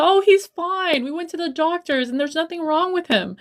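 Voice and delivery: high, airy imitation of a lying mom; mimicking mom's lie, high and dismissive; mock breezy mom. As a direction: brush it off sweetly like a mom hiding the truth. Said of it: "lie to my face and be like".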